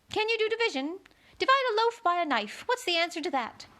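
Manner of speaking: high, tutting verdict. A narrator's reading. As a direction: cluck it high and prim.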